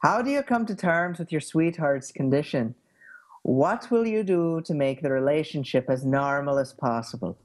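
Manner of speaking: put-on lilting Irish accent. Shown as a demonstration. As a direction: pose the question in an Irish accent. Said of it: "the Irish is probably the one I have the best handle on".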